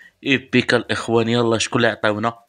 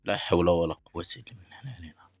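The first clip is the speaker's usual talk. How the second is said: whispered prayer; 3 semitones lower, 9 dB quieter than usual. Real, not acted. Prayer after a bad pull.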